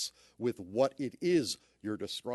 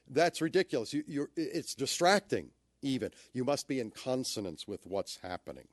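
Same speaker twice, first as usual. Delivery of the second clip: usual speaking voice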